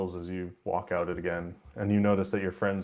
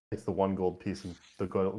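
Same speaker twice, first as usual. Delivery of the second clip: normal talking voice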